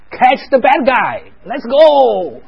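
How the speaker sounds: loud, high, drawn-out call to charge; rally small kids for a pretend chase; big slow playful shout; play-acted rallying cry. Playing police chase with toddlers.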